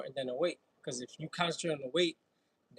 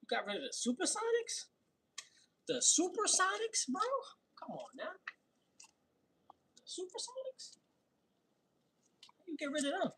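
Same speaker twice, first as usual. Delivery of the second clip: high, falsetto mock outrage; mock disbelief; 14 semitones higher, 6 dB quieter than usual. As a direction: squeak it out in disbelief. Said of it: "It was like, "What?".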